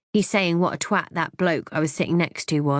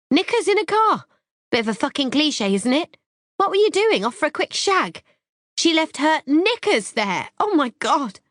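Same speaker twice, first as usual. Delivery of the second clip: high-pitched explosion; 10 semitones higher, 3 dB louder than usual. A narrator's reading. Wife exploding at husband through the door, high-pitched. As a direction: explode at him, high and shrill with rage. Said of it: "all high-pitched".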